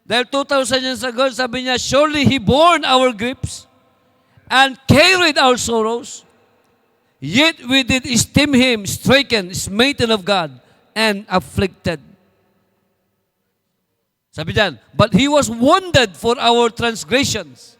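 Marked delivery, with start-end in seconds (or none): 1.8-12.0 slow, solemn Bible reading; scripture reading. Acted. Quotes the prophet in English. 14.9-17.7 slow, solemn Bible reading; scripture reading. Acted. Quotes scripture slowly.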